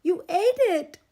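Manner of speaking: high, loud outraged yell; accusing shout. A narrator's reading. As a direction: accuse him at full volume. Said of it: "Iguana cried".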